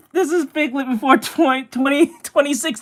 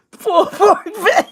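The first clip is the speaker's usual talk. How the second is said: laughing; 5 semitones higher, 3 dB louder than usual. Real, not acted. Repeats caption, cracking up.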